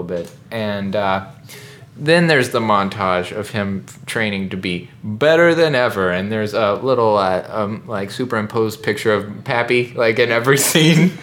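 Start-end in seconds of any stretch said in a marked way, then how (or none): none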